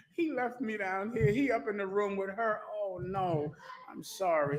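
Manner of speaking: soft, whimpering voice of a jilted wife; mock weepy sister. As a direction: whine it like a pitiful abandoned wife. Preacher voices a weeping sister.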